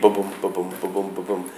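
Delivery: soft, low ba-BOOM beat, stress on each BOOM; quiet da-DUM rhythm demo; iambic beat. Shown as a demonstration. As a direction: tap out "ba-boom" softly with the weight on "boom". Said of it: "perfect iambic beat".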